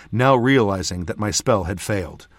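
usual speaking voice

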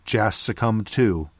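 ordinary delivery, nothing marked

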